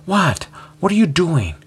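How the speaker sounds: sharp rising exasperation at her husband; exasperated. A narrator's reading.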